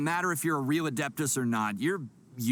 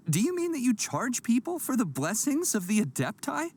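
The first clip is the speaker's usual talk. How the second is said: incredulous; 9 semitones higher than usual. Acted. Incredulous at paid blessings.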